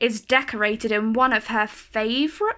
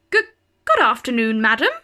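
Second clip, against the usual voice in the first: startled stammer, quick and louder; stuttering; 5 dB louder, faster than usual. A narrator's reading. Startled councillor stammering at the colourful lady. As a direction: trip over the greeting, flustered. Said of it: "stutters a stout gentleman".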